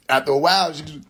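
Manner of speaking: ordinary talk, nothing marked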